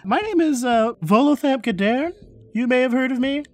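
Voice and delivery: lightly voiced self-promoting celebrity author; Volo. Celebrity author introducing himself to adventurers, chummy and self-promoting. Game master plays Volo.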